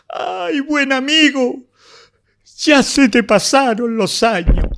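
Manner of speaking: mournful close of the poem, lightly voiced; poem recitation. Closing his poem to his friend, mournful recitation. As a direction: let the lament ease down, still sorrowful.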